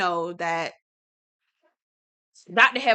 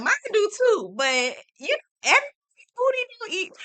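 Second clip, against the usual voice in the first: laughing; 12 semitones higher than usual. Retold or imitated. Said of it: "I was like".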